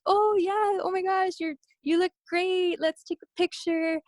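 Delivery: high, bubbly mock of a friendly star; friendly celebrity. Idol greeting a fan, imitated high and bubbly. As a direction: gush back warmly. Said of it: "he's all like".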